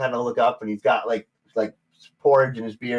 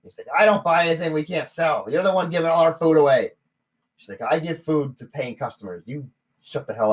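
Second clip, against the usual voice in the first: bickering gnomes; 8 semitones higher, 6 dB louder than usual. Acted.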